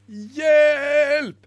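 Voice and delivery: high, loud, drawn-out cry; screamed cry for help. Acted. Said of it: "skrek Ibelina".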